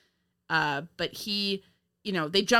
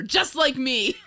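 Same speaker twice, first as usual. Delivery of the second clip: laughing; 7 semitones higher, slower than usual. Real, not acted. Joking about own hat.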